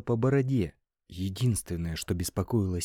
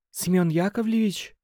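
secretary unsure on the phone, raised voice; hesitant question; 10 semitones higher, 5 dB louder than usual. A narrator's reading. Secretary surprised to hear her jailed boss, hesitant. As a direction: ask his name as if not believing it. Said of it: "нерешительно спросила она".

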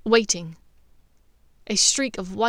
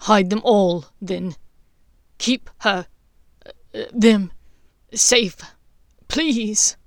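defiant plea; 6 dB louder, slower than usual. A narrator's reading. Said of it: "raised his head defiantly".

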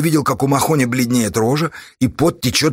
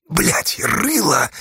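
powerless exhale; 5 semitones higher, much slower than usual. A narrator's reading. Burned Astasha curses his torturer, exhaling helplessly. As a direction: curse let out on the breath. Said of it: "бессильно выдохнул он".

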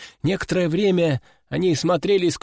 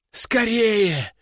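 urgent cry; 4 semitones higher than usual. A narrator's reading. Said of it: "не унимался окровавленный послушник".

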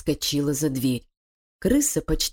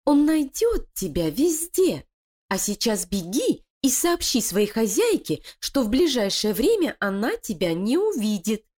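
high, sly voice of a plotting wife; scheming female voice; 6 semitones higher than usual. Acted. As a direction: persuade her partner, cold and cunning. Лилия прогоняет крысу. (коварная интриганка)

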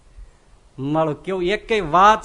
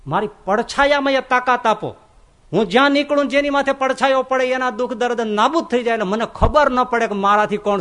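much higher, louder eager asking; fervent request; 6 semitones higher, 5 dB louder than usual. Retold or imitated. Sadhu asks God for power in his shadow, high and eager. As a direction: ask for the gift with rising fervour.

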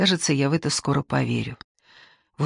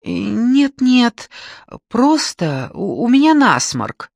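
flustered denial; 8 semitones higher, 4 dB louder, slower than usual. Acted. Crying wife denies crying.

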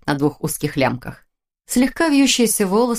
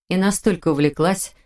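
ordinary delivery

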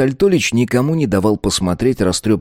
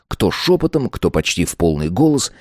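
ordinary delivery

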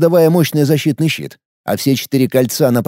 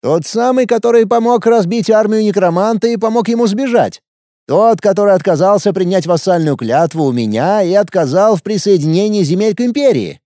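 put-on higher, boyish young emperor; teenage boy voice; 7 semitones higher, 3 dB louder than usual. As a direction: play an arrogant teenage ruler. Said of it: "спросил подростковый голос". (голос подростка-императора)

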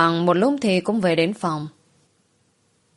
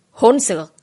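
angry rebuke; 3 semitones higher, 4 dB louder than usual. A narrator's reading. Angry master scolds her.

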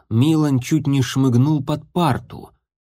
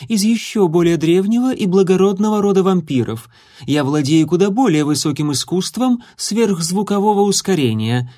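put-on haughty vampire girl; 7 semitones higher, 3 dB louder than usual. A narrator's reading. Rival vampire boasts.

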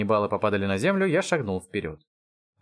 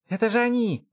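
high, voiced stage whisper of a kid; loud excited whisper; 12 semitones higher than usual. A narrator's reading. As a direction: exclaim it like an excited child. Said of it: "громко прошептал Робокоп". (взволнованный громкий детский шёпот)

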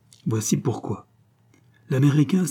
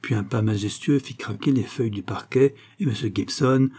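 usual speaking voice

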